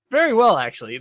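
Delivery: loud, high burst of amazement; amazed. Real, not acted. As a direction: gush about it. Marvels dog writes well.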